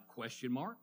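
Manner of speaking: ordinary delivery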